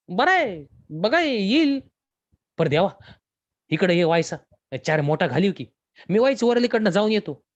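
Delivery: Santu's voice. Acted. Santu answers Deva, character voice.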